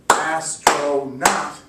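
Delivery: "Astronaut" very slow, "As-tro-naut", a beat for each part; slow syllable split. Shown as a demonstration. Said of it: "three distinct sounds".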